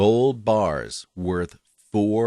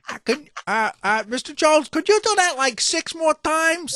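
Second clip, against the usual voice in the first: director voice. Pretend. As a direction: ask politely like a director. Playing a director to actor.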